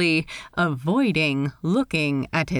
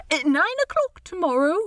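high, lilting, polite voice; awkward host in a high register; Steed, politely awkward; 9 semitones higher, faster than usual. Acted. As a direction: pitch it high, hesitate politely. Steed's dialogue voice.

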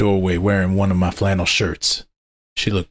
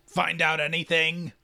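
higher and softer, sly; a sly pack leader prying; werewolf Alpha voice; 10 semitones higher, 6 dB quieter than usual. Acted. Werewolf Alpha asks narrator.

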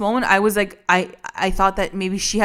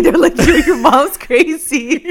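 laughing; 5 semitones higher, 4 dB louder, slower than usual. Real, not acted. Laughing while quoting classmates.

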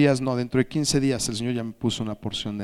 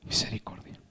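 barely voiced, reverent whisper; very soft whispered answer; hushed; 9 dB quieter than usual. Real, not acted. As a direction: whisper the word with awe. Preacher repeats the answer in a hush.

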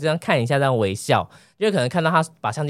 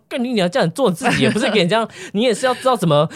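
inner fuming; 6 semitones higher than usual. Retold or imitated. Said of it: "我在气到想说".

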